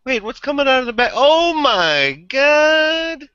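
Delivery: hammed-up horrified surprise; mock shocked. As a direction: act out horrified surprise at the movie. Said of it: "I'm like".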